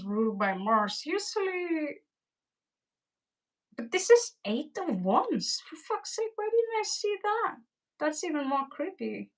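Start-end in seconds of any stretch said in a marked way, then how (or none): 5.7-7.5 kicking herself for missing it; exasperated surprise. Real, not acted. To her viewers about the card she missed, high exasperated surprise. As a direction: pitch jumps way up in disbelief.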